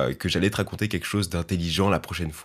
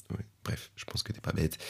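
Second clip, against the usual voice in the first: whispered aside; 11 dB quieter than usual. Real, not acted. Conspiratorial aside to listener.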